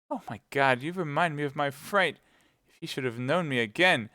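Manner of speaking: put-on high, anxious young woman; Kitty, frightened. A narrator's reading.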